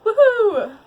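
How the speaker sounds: high, loud whoop; mock cheer. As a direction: whoop for the happy ending. Cheering the retold happy ending.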